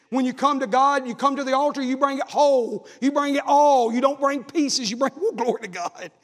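high, loud preaching climax; preacher calling sinners to the altar; full preaching. Acted. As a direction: drive it home, then break into praise.

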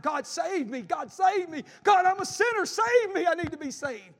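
retold jail inmate crying out to God. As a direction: cry out to God for saving, building to a shout. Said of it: "crying out".

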